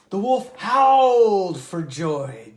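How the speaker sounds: slow jubilant howl-like read; joyful howl. A narrator's reading. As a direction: let the joy ring out like a howl. Wolf's joy in fable.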